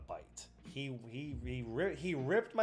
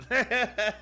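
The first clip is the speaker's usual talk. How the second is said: higher and louder, slowed by laughter; laughing; 12 semitones higher, 12 dB louder than usual. Real, not acted. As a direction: laugh it out, embarrassed but proud. Laughs through the line.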